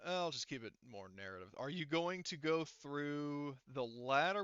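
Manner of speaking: ordinary talk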